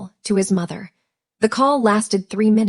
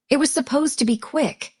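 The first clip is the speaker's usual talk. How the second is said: yelled back; 5 semitones higher than usual. A narrator's reading. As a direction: yell back in restrained desperation. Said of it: "he yelled back, losing all control".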